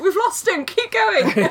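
high, laughing mock-heroic call; heroic soldier voice. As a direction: play the brave action-movie soldier. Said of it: "He was like".

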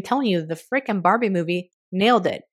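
ordinary talk

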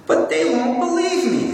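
put-on high-pitched childlike objection, read in character; Lucy's worried protest. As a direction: protest in a high little-girl voice. Reads Lucy's protest to Aslan.

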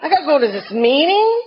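high, fast, incredulous outrage with laughter; mock-outraged Jersey girl wanting her man; squeaky disbelief, half laughing; indignant disbelief. Indignant retold reply to counselor.

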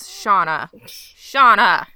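calling out. Acted. Scolding the character on screen, calling out. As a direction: call out to her across the room.

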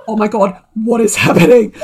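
half-laugh, half-freakout; laughing panic. Retold or imitated. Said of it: "And I'm like".

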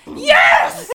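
loud, high cheer; real, whooping excitement; delighted yell. Real, not acted. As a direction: shout it with sudden glee. Delighted at the Buffy reference.

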